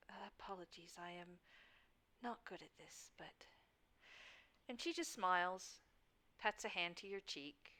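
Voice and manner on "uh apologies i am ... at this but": very soft, halting apology; hushed and hesitant; awkward apology. Acted. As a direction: apologise shyly, barely projecting. Flora awkwardly apologises in character.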